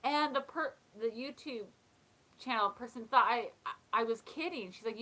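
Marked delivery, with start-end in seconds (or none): none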